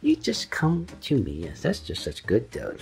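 dote absurdly on your dog; pet baby talk. Pretend. Silly doting talk to the dog.

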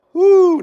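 sudden falsetto whoop, loud; a squeaky yelp to dodge the question; high woo. Real, not acted. Dodging awkward chat question.

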